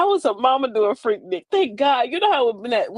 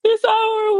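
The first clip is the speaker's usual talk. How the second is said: mock dramatic farewell; 11 semitones higher, 4 dB louder than usual. Mock dramatic exit announcement.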